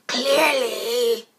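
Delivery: loud Clearly with a falling tune; emphatic loud Clearly. Shown as a demonstration. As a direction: belt out Clearly.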